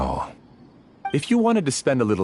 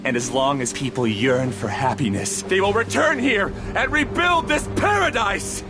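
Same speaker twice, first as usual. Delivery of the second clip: defiant villain rant; 9 semitones higher than usual. Acted. Defeated villain's defiant declaration.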